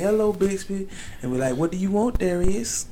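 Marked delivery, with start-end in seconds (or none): 0.0-0.9 soft, higher-pitched assistant greeting. Acted. 1.6-2.8 higher, soft British phone assistant; prim British assistant, like Mary Poppins. Acted.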